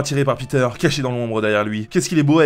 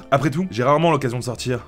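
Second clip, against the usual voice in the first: usual speaking voice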